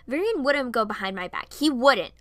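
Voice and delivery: loud, stammering disbelief; indignant, emphatic outburst; crying out. Acted. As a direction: cry out in shocked denial, voice jumping up. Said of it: "I cried out".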